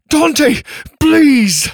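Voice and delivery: high, loud cry for help; desperate cry. Acted. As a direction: call out in panic, hanging on. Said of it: "he cried".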